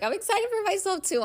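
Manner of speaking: high-pitched excited squeal of words; excited quote, pitched sky-high; retold excitement. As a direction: jump way up high, quoting your own giddy reply. Retelling own excited reply.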